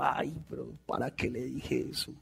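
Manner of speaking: quiet, rueful self-reproach; regretful mutter. Retold or imitated. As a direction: sigh it out under your breath, regretting. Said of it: "y dijo". (arrepentido, en voz baja)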